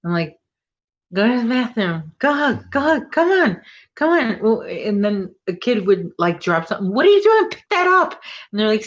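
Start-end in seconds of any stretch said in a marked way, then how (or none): 1.1-4.4 put-on climbing higher, go go come on, hustling the kids; impatient hurrying. School aide rushing kids to the bathroom, impatient and rising. 6.9-8.2 put-on much higher and louder, snapping at a kid; mock scolding. School aide telling off a kid for dropping something, high scolding.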